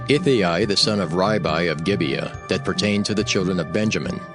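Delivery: normal talking voice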